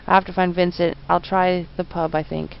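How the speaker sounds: normal talking voice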